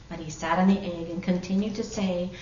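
ordinary delivery

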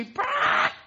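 high loud hen cluck. Acted. Hen following the girl to school, high loud cluck.

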